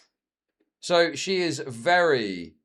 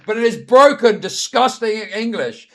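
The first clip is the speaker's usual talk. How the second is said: scornful mockery; 8 semitones higher, 11 dB louder than usual. Real, not acted. Mocking the guy's English.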